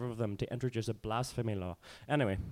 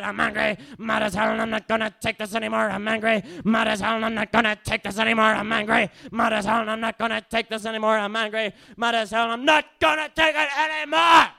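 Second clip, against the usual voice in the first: loud, furious refrain climbing to a shout; spoken-word performance; 9 semitones higher, 7 dB louder than usual. Acted. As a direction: hammer it out, exploding on the last line.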